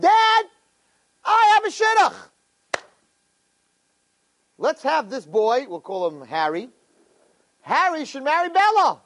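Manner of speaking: eager son. Acted. Scheming son urging his father, high and eager. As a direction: sell the plan to dad in a high bright pitch.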